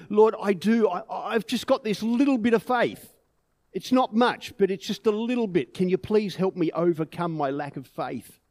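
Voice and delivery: high, loud acted begging, easing toward the end; desperate pleading. Father begging the Lord to help his son, acted desperate plea. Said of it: "And in desperation, the boy's father says".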